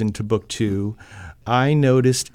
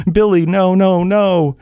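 desperate cry; 5 semitones higher, 4 dB louder than usual. Acted. Mother cries for husband.

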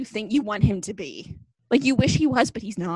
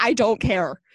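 normal talking voice

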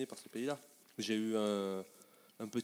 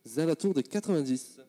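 repeated louder for the mic; louder retake; 4 semitones higher, 11 dB louder than usual. Shown as a demonstration. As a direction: say it again, louder and clearer. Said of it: "C'est bon comme ça?". (répété plus fort)

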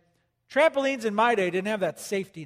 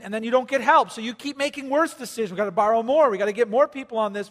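ordinary delivery

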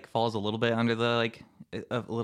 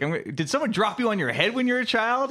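exasperated quote; 9 semitones higher than usual. Retold or imitated. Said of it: "he's like".

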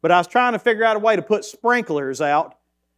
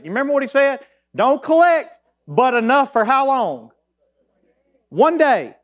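fired-up preaching; 6 semitones higher than usual. Acted. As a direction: preach it to the congregation with growing fervour. Preaching build.

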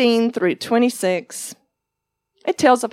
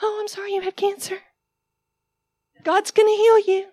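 mock dismissive comfort; 10 semitones higher, faster than usual. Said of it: "I can't just walk around and".

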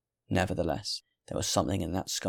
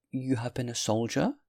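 higher-voiced, curious question; inquiring old woman; 4 semitones higher than usual. A narrator's reading. Good woman questions the stranger, gently raised inquiring voice. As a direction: lift the pitch and rise at the end.

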